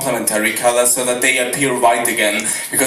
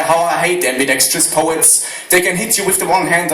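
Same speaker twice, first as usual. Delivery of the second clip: ordinary talk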